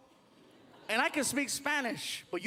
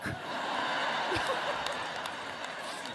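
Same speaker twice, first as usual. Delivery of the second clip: breathless high laugh; high wheezing laugh; 4 dB quieter than usual. Real, not acted. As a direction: crack up silently. Cracking up at recording.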